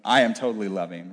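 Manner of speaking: low, mock self-assured reply; imagined smug listeners. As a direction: imitate the congregation quietly excusing themselves. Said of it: "All of you are like".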